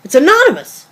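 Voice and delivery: rising, loud, high outrage; indignant loud protest, pitch climbing; upset protest. Acted. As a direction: push back hard, she's been exposed. Wife caught reading her blog.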